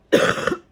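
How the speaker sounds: real hacking cough; very loud, repeated coughing burst. Real, not acted. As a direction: cough hard to clear the lungs. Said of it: "you gotta cough out your gunk".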